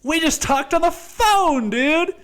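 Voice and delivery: high hammed-up grievance; whiny mock outrage. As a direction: whine it like a spoiled brat. Repeat, whiny high mocking.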